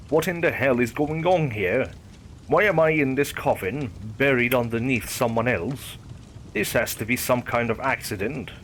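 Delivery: ordinary delivery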